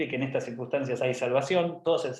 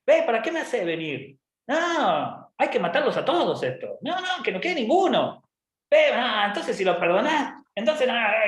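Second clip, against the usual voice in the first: high, wailing protest; hammed-up whiny outrage; tantrum-throwing Jonah; 8 semitones higher than usual. Jonah arguing with God, high petulant tantrum. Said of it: "Jonás se queda como diciendo".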